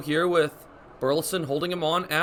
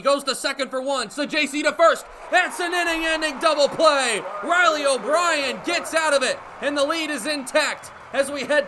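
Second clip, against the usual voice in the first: excited double play call; 8 semitones higher than usual. Acted. Announcer calling an inning-ending double play. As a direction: punch the end of the inning.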